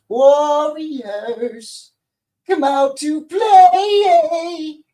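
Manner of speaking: high, loud sing-song call, drawn out; taunting movie villain. Retold or imitated. Quoting a movie villain to viewers, taunting sing-song. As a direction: call out the taunt, stretching the last word way out. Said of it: "He says".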